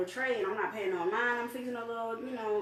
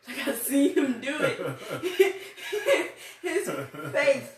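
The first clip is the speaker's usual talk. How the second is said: high, loud laugh through the words; real amused outburst; 7 semitones higher, 6 dB louder, slower than usual. Real, not acted. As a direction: say it cracking up, pitched up. Recalls a funny face, laughing.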